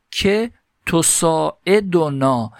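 teacher slowly voicing the Arabic example for students; slow recitation. Shown as a demonstration. Teacher to students, reading the Arabic text slowly. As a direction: read مثل ثاقبون slowly, each syllable drawn out.

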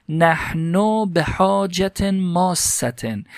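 teacher slowly voicing the Arabic example for students; slow recitation. Shown as a demonstration. Teacher to students, reading the Arabic text slowly. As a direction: read مثل ثاقبون slowly, each syllable drawn out.

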